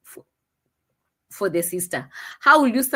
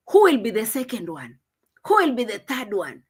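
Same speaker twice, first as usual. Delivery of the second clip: ordinary talk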